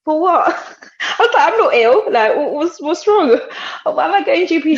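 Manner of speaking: high, loud incredulous protest; retold disbelief, high and loud; shocked disbelief.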